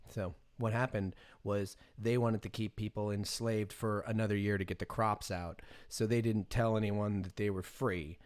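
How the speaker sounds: ordinary talk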